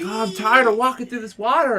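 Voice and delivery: mock the friend's whine; imitating a whiny complaining kid; whiny complaint. Friend complaining, retold whiny quote.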